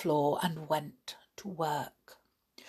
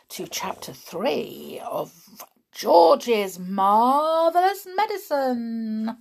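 ordinary delivery

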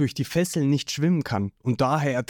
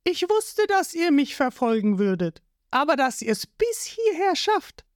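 put-on much higher, taunting girl's voice; Nami's voice; 14 semitones higher than usual. A narrator's reading. Nami walking up to Zorro, teasing high. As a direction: tease him in a high cocky tone. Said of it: "Sie geht auf Zorro zu und sagt".

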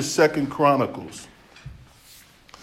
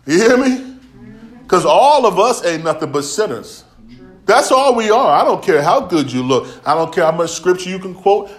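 raised, loud pulpit exhortation; forceful preaching; 4 semitones higher, 7 dB louder than usual. Real, not acted. Preacher telling congregation all are sinners, forceful exhortation. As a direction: press the congregation hard, voice lifted.

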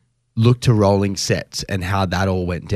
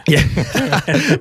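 ordinary talk

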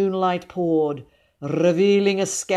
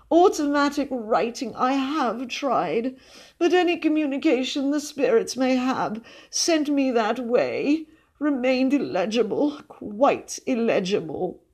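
high, theatrical lament; sighing spiritualist lady; 8 semitones higher than usual. A narrator's reading. Cousin laments her failed spirit writing, sighing. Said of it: "she sighed".

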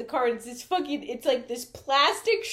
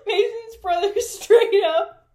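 cracking up mid-sentence; laughing hard; 14 semitones higher, 11 dB louder than usual. Real, not acted. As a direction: talk through big laughter. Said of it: "What the fuck?".

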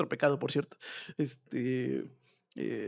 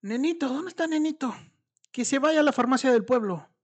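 higher, louder imitated call; 10 semitones higher, 5 dB louder than usual. Grandmother demanding the missing man go to the pharmacy, imitated shout. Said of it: "Y entonces dice".

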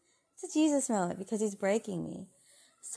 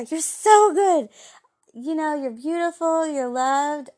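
much higher and louder, slowed right down; excited cry; 8 semitones higher, 10 dB louder than usual. Real, not acted. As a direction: belt it out as heartfelt praise. Encouraging listeners, voice leaps up.

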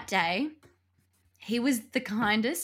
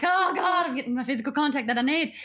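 loud, high gush of relief; hammed-up ecstatic relief; mock bliss; 6 semitones higher, 4 dB louder than usual. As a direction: gush with exaggerated relief, quickly. Acting her blissful relief while massaging.

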